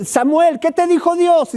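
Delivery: high, pressing Eli asking Samuel. Acted. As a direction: voice the old priest begging for the word. Eli voiced pressing again.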